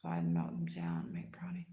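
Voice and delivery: low unvoiced whisper. Real, not acted. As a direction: whisper it like a secret. Dreamy whisper of recipe.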